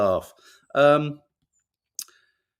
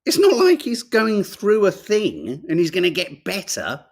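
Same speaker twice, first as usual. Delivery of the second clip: usual speaking voice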